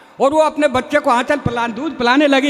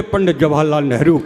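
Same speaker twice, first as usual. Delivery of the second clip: lightly voiced simpleton, much lower; a hint of a clueless candidate, dropped low; dumb candidate answer; 10 semitones lower than usual. Dumb student answers interviewer.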